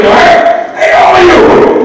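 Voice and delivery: high, pushed Bostonian cartoon greeting; Bostonian accent. Shown as a demonstration. Actor demonstrating a first character voice, Bostonian accent. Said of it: "like a Bostonian accent".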